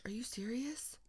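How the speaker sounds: hushed, voiceless incredulity; very soft exasperated whisper; whispered disbelief. Real, not acted. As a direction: whisper it in dismay. Disbelief at the flying blaze.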